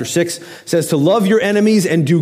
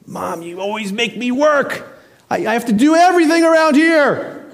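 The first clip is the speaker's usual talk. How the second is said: put-on whiny kid; 7 semitones higher than usual. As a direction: whine like a put-upon child. Child whining to mom.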